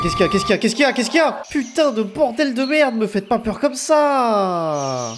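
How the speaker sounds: panicked high shout at a sudden scare; startled yell. Real, not acted. Gamer jumps at a night mob, panicked yell. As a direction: yell in real fright at the monster.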